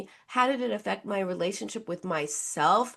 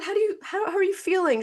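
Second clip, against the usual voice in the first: therapist mom; 8 semitones higher, faster than usual. Retold or imitated. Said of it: "I said".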